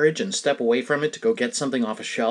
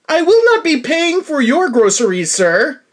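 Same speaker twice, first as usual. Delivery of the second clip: higher and louder teasing declaration; loud mock-stern; 10 semitones higher, 6 dB louder than usual. Teasing the stranger at the cart, loud mock-stern. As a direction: boom it out as a playful joke. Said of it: "and loudly said".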